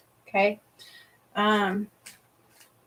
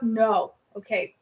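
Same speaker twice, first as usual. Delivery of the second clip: ordinary talk, nothing marked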